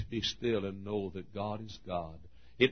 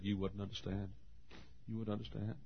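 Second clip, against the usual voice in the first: subdued reply; 11 dB quieter than usual. Retold or imitated. Retells his low-key reply, twice.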